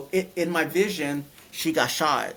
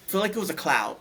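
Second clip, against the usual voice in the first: ordinary talk, nothing marked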